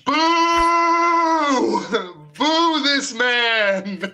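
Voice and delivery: drawn-out high shout; loud booing. Pretend. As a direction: heckle him with a long boo. Booing co-host's bad pun.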